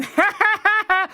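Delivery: high mocking cackle; jeering laugh. A narrator's reading. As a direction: laugh down at him loudly. Brothers laughing at fool.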